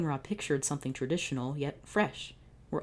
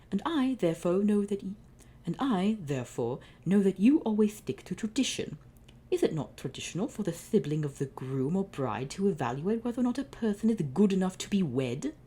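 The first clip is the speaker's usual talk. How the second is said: smug villain brother; 5 semitones higher than usual. Acted. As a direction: lord it over him like a gloating sibling.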